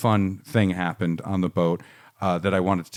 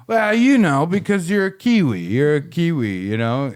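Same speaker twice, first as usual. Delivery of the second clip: put-on much higher and louder, sloppy drunk needling; drunk guy; 6 semitones higher, 6 dB louder than usual. Drunk man keeps calling Steve a Kiwi, loud mocking. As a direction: squeal it like a smug drunk pushing his luck. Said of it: "and then he's like".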